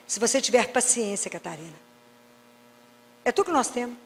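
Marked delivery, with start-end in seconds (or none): 0.1-1.8 softer, lower, patient; quiet fading tender voice; tender answer. Pretend. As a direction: say it as a calm loving reply. God answers Catarina.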